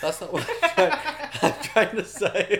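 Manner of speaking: helpless loud giggling as you talk; laughing. Real, not acted. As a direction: try to get it out through laughter. Cracking up while correcting herself.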